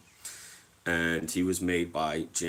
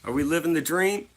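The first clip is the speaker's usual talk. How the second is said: higher and louder, pumped-up hello; hyped greeting; 9 semitones higher, 8 dB louder than usual. Real, not acted. Greeting a viewer in chat, higher and louder hype. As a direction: shout out the viewer with energy.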